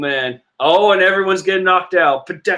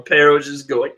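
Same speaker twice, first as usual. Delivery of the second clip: ordinary talk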